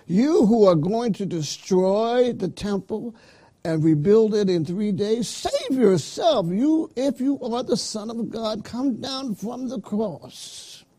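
declaimed taunt, pitched high and emphatic; high, stretched-out scriptural mockery; jeering crowd. Reads the mockers' taunt at Jesus.

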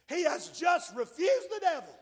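high and loud proclamation; fiery preacher shout; preaching shout. Real, not acted. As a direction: shout it out to the church. Preaching climax.

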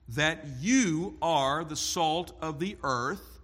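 you hit hard, the rest slow and deliberate; stress on you. Retold or imitated. Preacher stressing you to his congregation, slow and weighty. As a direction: lean on the word you as Christ's emphasis. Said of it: "the pronoun you is emphatic".

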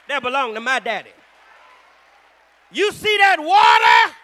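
high, loud, slow shouted preaching; preaching at full force. Acted. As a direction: build the sermon to a roaring climax.

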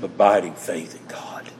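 soft awed whisper; hushed whisper. Real, not acted. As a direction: whisper it reverently, almost unvoiced. Reverent point about deep faith.

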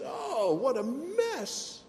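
high, slow pained cry; anguished "Oh, what a mess"; pained lament. Acted. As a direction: cry it out like a groan.

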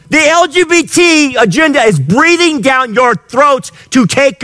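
high, impassioned sermon voice; a preacher driving the point home; fiery preaching. Acted. Preaching at full force.

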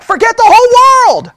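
high, loud acted shout; full-voiced enraged yell; furious outburst. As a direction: explode in rage at the peak of a rant. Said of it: "I would be furious".

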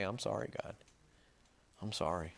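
low, soft plea to God; humble apology. Acted. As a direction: act out a subdued, kneeling repentance. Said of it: "get down on your knees and say".

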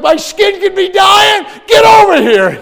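terrified patient yelling for help; panicked shout. Retold or imitated. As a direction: scream for the doctor to hurry. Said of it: "I'm like".

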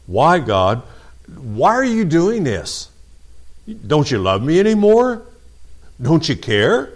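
imitating a hurt believer questioning God; complaining prayer. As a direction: plead like someone who feels abandoned.

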